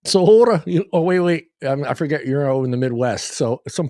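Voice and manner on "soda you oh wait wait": high, loud puzzled question; a startled, louder "soda?"; surprised. Real, not acted. To his guest who asked for soda, surprised. Surprised at friend's word choice.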